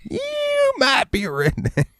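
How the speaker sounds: slow, drawn-out comedian catchphrase; hammed-up stand-up punchline; Jeff Foxworthy. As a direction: stretch out the opening word, high and long. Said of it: "The fucking ghost of Jeff Foxworthy".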